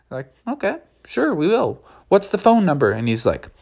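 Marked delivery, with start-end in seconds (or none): 0.5-2.9 prim clerk voice. Acted. Clerks reply, high.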